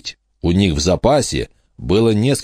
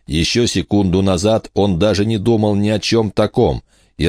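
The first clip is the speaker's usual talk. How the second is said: ordinary delivery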